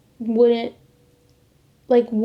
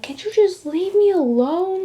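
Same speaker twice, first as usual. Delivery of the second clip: high-pitched exasperated outburst, imitating herself; retold snap at a teacher, pitch way up; blowing up; 8 semitones higher than usual. Student blows up at her teacher in class, retold exasperated outburst. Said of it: "I blew up on her".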